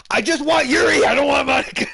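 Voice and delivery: high, loud excited yell; excited shout. Real, not acted. Player yelling at the game about wanting Yuri, high excited shout. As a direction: shout it at the screen.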